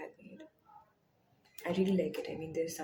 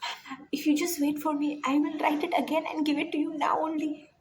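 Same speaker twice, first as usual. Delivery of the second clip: put-on eager child; 8 semitones higher, 4 dB louder than usual. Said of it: "She's like".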